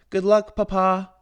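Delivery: high, loud shout to her father. A narrator's reading. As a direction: call after the wagon. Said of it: "shouted Belle".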